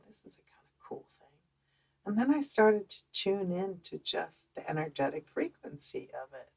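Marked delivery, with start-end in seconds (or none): 0.1-1.3 fast, much softer whisper; a quick, hushed aside to herself; whispered thought. Retold or imitated. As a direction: breathe it as a secret discovery. Quiet inner thought meeting fear.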